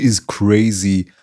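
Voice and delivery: normal talking voice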